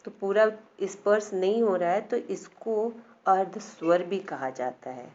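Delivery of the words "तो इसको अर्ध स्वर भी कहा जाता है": normal talking voice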